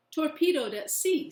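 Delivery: loud, high, eager answer; a boy shouting his news; eager boyish call. Acted. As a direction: blurt it out, excited. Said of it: "says Billy".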